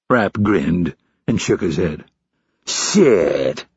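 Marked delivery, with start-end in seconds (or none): 2.7-3.7 long, falling, disgusted curse; drawn-out disgust. A narrator's reading. Instructor disgusted at recruit's persistence, drawing it out. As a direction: stretch the word out in disgust. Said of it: "Hurley drew out the word".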